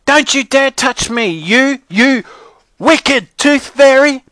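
put-on high, loud child's scream; screaming little girl. A narrator's reading. Brianna fends off the tooth fairy, screaming. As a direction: scream it at the top of your lungs. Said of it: "screamed at the top of her lungs".

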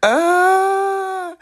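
a high, pained cry-laugh of embarrassment; high cry-laugh groan. Real, not acted. Recalling the Walmart walk, a high embarrassed cry-laugh. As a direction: whimper out the memory half laughing.

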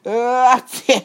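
very loud high acted sneeze; hammed-up thunderous sneeze; enormous sneeze. A narrator's reading. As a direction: explode in a huge drawn-out sneeze. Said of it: "Karabasz Barabasz akkorát tüsszentett".

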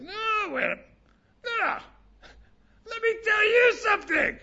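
high, shouting, hammed-up angry person; mock angry outburst. Teacher mocking an angry arguer, high mock shout. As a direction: squeal it like someone losing their temper.

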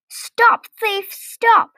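high-pitched cry of alarm; shouted. A narrator's reading. Voices cry after Toad driving off, high shout. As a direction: call out sharply after a thief, pitched high. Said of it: "shouted voices".

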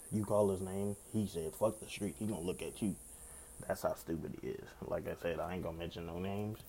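ordinary talk

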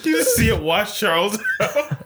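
laughing. Real, not acted. Laughing at friend's fate.

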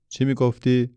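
high-pitched request to repeat. A narrator's reading. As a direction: ask high and a bit forcefully, not having heard. Bends down, did not hear boy.

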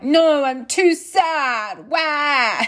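slow, high, hammed-up sulky whine; a mock wail on the last word; mock whiny crying. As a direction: play the sad pregnant wife as a joke. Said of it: "I was like".

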